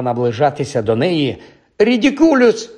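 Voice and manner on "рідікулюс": high, forceful cry; shouted spell. A narrator's reading. Pupil casts at the approaching mummy, high shout. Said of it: "вигукнула Парваті".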